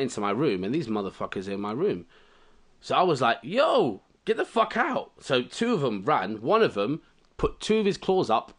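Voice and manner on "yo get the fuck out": high-pitched, indignant yelp; high, outraged demand to leave; indignant retold order. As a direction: shoo out an intruder in a high voice. Retold ordering crabs out of room.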